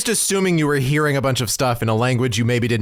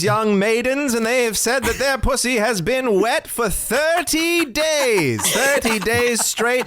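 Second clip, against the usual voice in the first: carnival barker voice; 7 semitones higher than usual. Retold or imitated. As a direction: sell it like a sideshow barker. Continues barker pitch impression.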